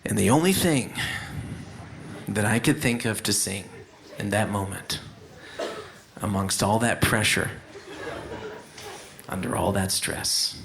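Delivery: low, soft, halting confession; subdued deadpan. Acted. Storyteller confessing his song choice under pressure, hushed deadpan. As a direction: drop low and quiet, pausing before the punchline. Deadpan punchline after the frightening climax.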